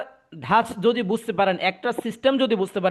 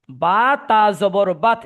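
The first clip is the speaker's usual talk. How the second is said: slow, louder teaching recitation; slow spelling-out; 2 semitones higher, 5 dB louder, slower than usual. Shown as a demonstration. Teacher re-explains jazm to confused student, slow recitation. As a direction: stretch each letter name out firmly for the class.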